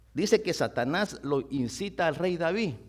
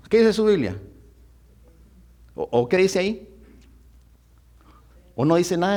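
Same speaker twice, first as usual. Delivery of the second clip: loud, emphatic sermon delivery; fervent preacher calling out the verse; preaching; 7 dB louder than usual. Acted. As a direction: preach it out to the congregation. Preacher explains Bible verse.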